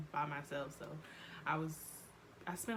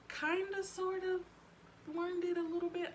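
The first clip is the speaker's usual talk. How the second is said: sheepish admission; 13 semitones higher, 4 dB louder than usual. Real, not acted. Sheepish admission about Portuguese.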